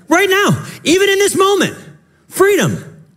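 high, ringing emphasis on now; emphatic preaching. Real, not acted. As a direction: lift it up and drive it home. Preacher stressing freedom now.

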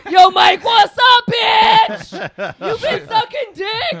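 loud, high mock yell at the husband; hammed-up shout of a tough visitor; mock prison greeting. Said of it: "I'd be like".

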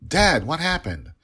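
put-on high, loud child's question; alarmed child. Three-year-old asks her father about the nanny turned to dust, alarmed.

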